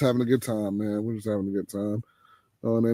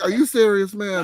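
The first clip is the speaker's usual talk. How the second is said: higher, exasperated disbelief; incredulous; 7 semitones higher than usual. Retold or imitated. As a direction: complain at the screen in disbelief. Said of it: "I'm like".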